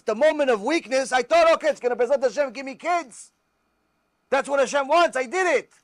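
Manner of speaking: confessing in a rush as the distraught wife; wife's confession. Retold or imitated.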